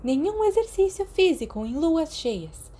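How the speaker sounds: leve repreensão da enfermeira. A narrator's reading. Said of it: "Madame Pomfrey o repreendeu levemente". (bronca leve de enfermeira, voz mais aguda)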